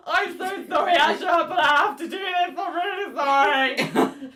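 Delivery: mock-tearful apology. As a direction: apologise as if near tears. Apologising before shooting her dad.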